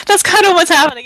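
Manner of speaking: loud, high, on the tail of laughter; amused, laughing. Real, not acted. Game master explaining the duck run to players, laughing. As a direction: blurt it out through real amusement.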